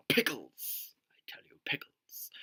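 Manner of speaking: hushed, conspiratorial whisper; mock whisper. Vlogger joking about pickles in their brain, mock whisper. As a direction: whisper it like a silly secret.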